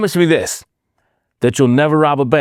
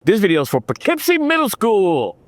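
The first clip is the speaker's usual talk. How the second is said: high, big hype announcement; hyped announcer; 6 semitones higher than usual. Shown as a demonstration. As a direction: announce it like the crowd is roaring. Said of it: "Let's try it again".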